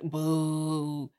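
mock boo. Booing the colonizer arrival.

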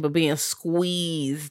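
ordinary talk, nothing marked

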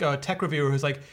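normal talking voice

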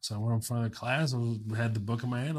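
normal talking voice

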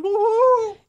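high, drawn-out whoop; mock cheer. Co-host mocking a rally crowd, mock cheer.

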